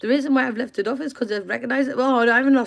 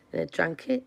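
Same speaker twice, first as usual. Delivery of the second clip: usual speaking voice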